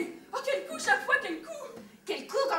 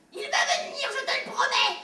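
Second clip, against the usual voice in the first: higher, laughing parody of the promise; mocking repeat; 7 semitones higher than usual. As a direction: throw her words back with a sneering laugh. Brother mocks mother's promise.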